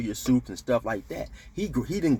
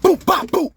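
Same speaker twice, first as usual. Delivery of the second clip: high, loud string of bops; imitated punch noises; 7 dB louder than usual. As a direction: sound out each landed blow. Voicing punches after rushing him.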